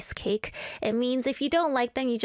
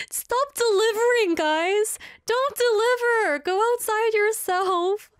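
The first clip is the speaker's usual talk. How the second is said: high, louder pleading to a little robot; half-joking heartbroken plea, pitched up high; mock tearful plea; 10 semitones higher, 4 dB louder than usual. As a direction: beg the poor robot to stop working.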